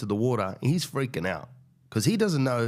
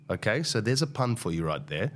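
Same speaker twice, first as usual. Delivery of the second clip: ordinary delivery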